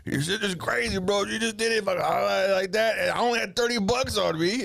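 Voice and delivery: high, excited retelling as dad; bursting with disbelief, pitch up. Retold or imitated.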